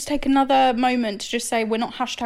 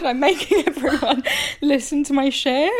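laughing embarrassed; 2 semitones higher than usual. Real, not acted.

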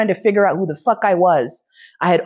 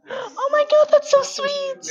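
high, slow gushing "so sweet"; touched squeal; slower than usual. Real, not acted. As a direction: melt over it, way up high. Touched by partner's remark.

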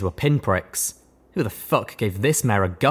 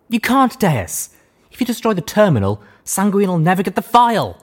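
urgent pleading; 11 semitones higher, 6 dB louder than usual. A narrator's reading.

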